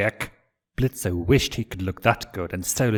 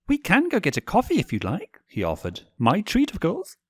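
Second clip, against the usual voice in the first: much higher, gracious offer; genteel prince; 11 semitones higher than usual. Acted. Stolas offering to buy Blitz coffee, high and gracious. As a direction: treat him, high and courtly.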